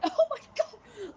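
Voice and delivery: re-enacted high, loud, slow wail; upset and flustered. Acting out their own upset during the argument, flustered high wail. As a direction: stretch it out in a high, tearful mock cry. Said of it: "getting really upset and flustered".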